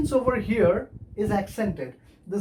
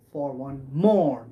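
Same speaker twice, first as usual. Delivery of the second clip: low "for one", strong drawn-out "more"; two light syllables, then hit "more"; slow demonstration with the weight on "more"; anapaestic stress; 6 semitones lower, slower than usual. Shown as a demonstration. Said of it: "That is how it is pronounced".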